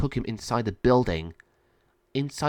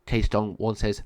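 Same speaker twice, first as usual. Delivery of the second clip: plain reading voice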